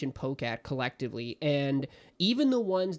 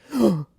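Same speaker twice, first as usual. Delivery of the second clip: dramatic gasp; 6 semitones higher, 8 dB louder than usual. Retold or imitated. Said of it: "they're like".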